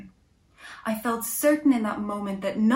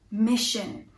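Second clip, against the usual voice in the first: usual speaking voice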